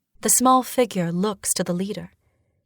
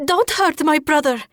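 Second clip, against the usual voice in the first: high, loud desperate plea; pleading shout; 9 semitones higher, 5 dB louder than usual. Acted. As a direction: beg loudly for his life. Sister begs for brother's life.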